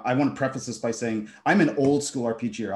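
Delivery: ordinary talk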